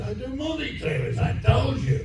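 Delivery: high, soft imitated exasperation; mock-impatient high producer voice; exasperated producer. Acted producer exasperated at question.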